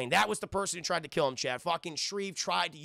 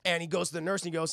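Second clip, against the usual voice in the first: ordinary talk, nothing marked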